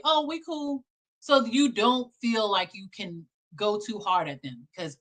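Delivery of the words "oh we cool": high, breezy fake nice voice; lightly mocking a false pal; mock fake friendliness. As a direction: play it off as friendly. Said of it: "they try to say".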